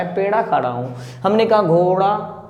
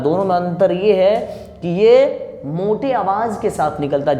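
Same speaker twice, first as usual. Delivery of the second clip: ordinary delivery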